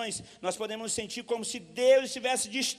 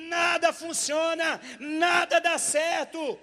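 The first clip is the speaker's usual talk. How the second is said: shouted resolve; 6 semitones higher, 6 dB louder than usual. Acted. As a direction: voice the sinner who has had enough. Repentant person voiced, shouting. (grito de decisão do arrependido)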